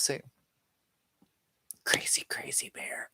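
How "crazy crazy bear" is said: breathy, amused whisper; whispered aside. Real, not acted. Streamer chuckling to herself over a viewer's name, whispering. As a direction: murmur the name to yourself.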